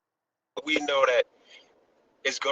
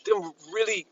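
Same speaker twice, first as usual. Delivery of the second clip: put-on higher, amused look-back voice, remembering an old nightmare; light mock-nostalgic voice, pitched higher; future self looking back; 6 semitones higher than usual. Future people recalling the past, in a higher, lightly amused voice. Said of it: "we're gonna look back and go".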